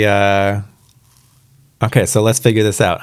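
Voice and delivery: usual speaking voice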